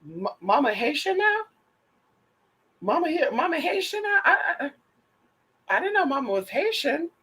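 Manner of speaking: hammed-up shock at her new accent; mock surprise. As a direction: act scandalized by the news. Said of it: "I was like".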